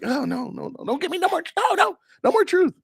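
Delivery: very high squealing protest; hammed-up whimpering refusal; mock panic. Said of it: "does the truth hurt? It's like".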